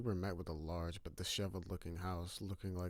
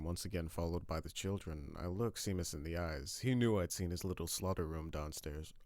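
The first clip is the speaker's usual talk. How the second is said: usual speaking voice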